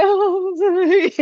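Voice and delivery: high wavering mock sob; imitated crying. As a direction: act out bawling on one drawn-out "oh". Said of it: "I be crying like a motherfucker sometimes".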